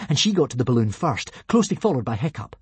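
plain reading voice

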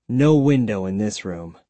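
plain reading voice

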